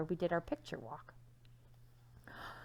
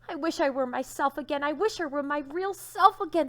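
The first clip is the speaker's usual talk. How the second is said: high, louder pleading wish; longing wish; 8 semitones higher, 8 dB louder than usual. A narrator's reading. Turned-to-stone son wishing to be himself, high and pleading. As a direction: wish it with everything. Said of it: "thought Sylvester".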